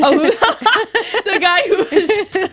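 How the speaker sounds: high, breathless laughing words. Real, not acted. Laughing through embarrassed answer.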